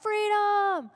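high, loud rallying yell of freedom; Mel Gibson battle cry. Retold or imitated. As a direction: bellow it like a warrior rallying his men. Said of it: "he's yelling out".

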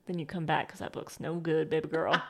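soft, smooth pickup-line delivery; smooth suitor. Retold or imitated. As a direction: ease it out like a charmer. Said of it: "quoting the smooth suitor".

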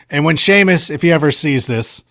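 ordinary delivery

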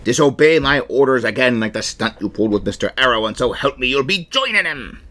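threatening pirate, climbing at the end. Acted. Captain threatening a disobedient crewman, pirate voice. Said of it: "I'm gonna do a pirate voice".

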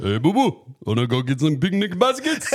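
loud, lifted cartoon bear voice; bouncy hammed-up bear impression; Yogi Bear. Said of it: "I think I can do better".

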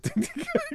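short high laugh. Real, not acted. Laughing at a bad move.

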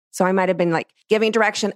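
ordinary talk

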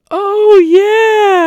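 squealed very high and loud; mock squealing bride accepting the proposal; Miss Elizabeth saying yes. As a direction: shriek the yes like an overjoyed bride. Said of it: "then she goes".

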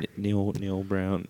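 very soft, halting start; too quiet to hear. Pretend. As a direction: keep the name barely audible. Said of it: "A little bit louder".

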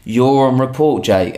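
loud, accusing snap; angry mum. Retold or imitated. Mum rounds on Jake, loud and angry. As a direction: turn the anger on the son. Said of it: "the angry energy".

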